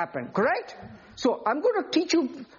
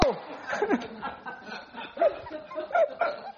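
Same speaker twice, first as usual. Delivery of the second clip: high laugh; 12 semitones higher, 6 dB quieter than usual. Real, not acted. Preacher laughs at own joke.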